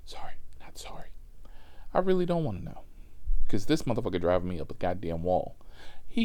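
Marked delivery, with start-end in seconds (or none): 0.1-1.1 soft, breath-only mock apology; whispered aside. Real, not acted. Comic half-apologizing to listeners, whispered aside. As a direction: whisper it as a throwaway. Muttered aside after admission.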